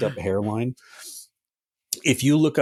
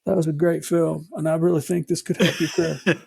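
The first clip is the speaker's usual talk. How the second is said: higher-pitched politician impression; Bill Clinton; 6 semitones higher than usual. Podcaster playing the former president praising her movies, Clinton impression. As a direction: put on a high, schmoozing politician voice. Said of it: "My Bill Clinton sound".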